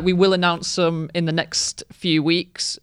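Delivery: ordinary talk, nothing marked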